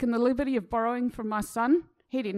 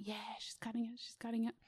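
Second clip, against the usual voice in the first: much softer, absent inner voice; zoned-out murmur; 13 dB quieter than usual. Retold or imitated. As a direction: drift off, barely there.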